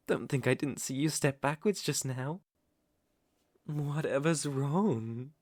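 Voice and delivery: normal talking voice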